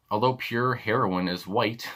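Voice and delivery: usual speaking voice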